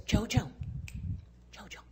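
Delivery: soft coaxing whisper fading almost to nothing; gentle teacher. Retold or imitated. Teacher softly comforting the crying girl at her recital. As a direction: coax a crying child kindly. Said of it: "she went like this".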